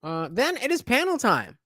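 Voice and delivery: hyped host. Acted. Host hyping panel time.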